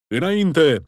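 loud rallying roar, pitch up; roaring command. A narrator's reading. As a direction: roar Înainte! to drive the men forward. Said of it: "răcni".

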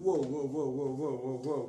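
loud startled wow, over and over; luid wow. Real, not acted. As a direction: cry out at the crashing guitar. Geschrokken van vallende gitaar. (luide, verraste wow-kreten om de vallende gitaar)

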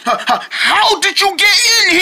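high, stammering fright; frightened stammer. Acted. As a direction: stutter in panic.

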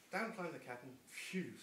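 ordinary delivery, nothing marked

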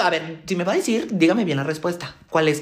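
ordinary delivery

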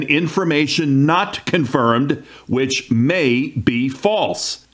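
slow, deliberate repeat with weight on "false"; drawn-out "may be false" for emphasis; emphatic repeat. Real, not acted. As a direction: spell it out slowly, landing hard on "false". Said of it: "that's the key word".

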